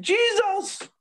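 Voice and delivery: shrill high cry of "Jesus!" from the woman; mock shriek. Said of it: "She said".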